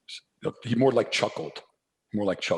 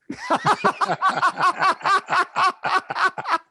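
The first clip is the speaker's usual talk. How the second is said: high, loud burst of laughter; real loud laugh; big laugh; 5 dB louder than usual. Real, not acted. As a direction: laugh hard at your own joke. Laughs at own answer.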